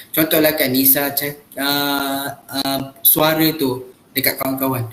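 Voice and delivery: usual speaking voice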